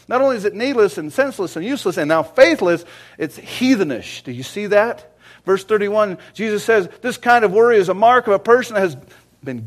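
loud, high preaching voice; rising sermon fervor; fiery preaching. Real, not acted. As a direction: preach it hard to the congregation. Preaching at full force.

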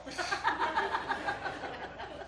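real high laughter. Real, not acted. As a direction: burst out laughing. Laughs at the bad guy.

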